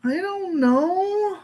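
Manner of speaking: slow, high, stretched-out I don't know; drawn-out uncertainty. Real, not acted. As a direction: stretch it out, unsure. Unsure drawn-out answer.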